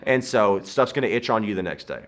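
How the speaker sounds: ordinary talk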